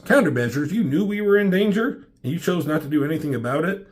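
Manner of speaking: captain scolding Data, mock outrage; angry captain. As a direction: demand answers in disbelief.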